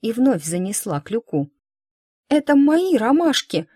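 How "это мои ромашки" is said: put-on high girl's protest, stress on мои, close to crying; girl crying out near tears. A narrator's reading. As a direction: cry out "мои" as a hurt little girl. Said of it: "Чуть не плача ... воскликнула девочка".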